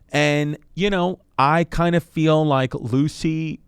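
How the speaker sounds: slow, loud pitch voice; drawn-out ad pitch; ad read. Acted. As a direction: lean into the sell slowly.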